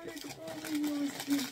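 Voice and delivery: high, slow cooing over a cute teddy. Real, not acted. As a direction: melt over the bear in a high, slow coo.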